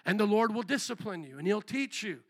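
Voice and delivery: ordinary delivery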